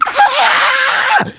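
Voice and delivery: very high scream of pain. Acted. Armored man screaming as pain hits his head, high acted scream. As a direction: scream out high, acting sudden agony. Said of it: "screams out".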